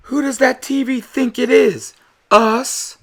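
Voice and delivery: high, indignant parent voice; outraged parent. Acted. Todd's parents complaining about the TV, high and indignant. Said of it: "Todd's parents were outraged".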